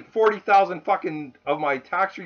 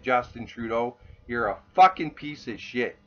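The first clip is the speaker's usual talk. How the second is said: ordinary talk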